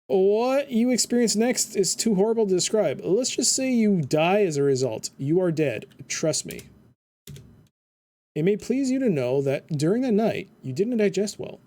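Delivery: deadpan narrator reading; game narration. Acted. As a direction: narrate the death with dry humour. Reads game narration aloud.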